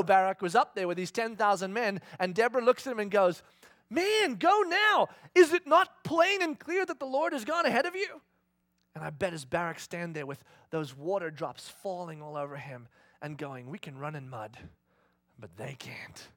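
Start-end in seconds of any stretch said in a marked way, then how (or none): 3.9-8.2 urgent command. Acted. Deborah urging Barak in the rain. 13.7-16.3 quiet. Acted. Barak realizing, under the rain.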